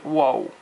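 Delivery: wo with an English oo glide at the end; wrong wo. Shown as a demonstration. Teacher shows the wrong wo, sliding into oo. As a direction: let wo slide into an oo. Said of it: "不是 wo".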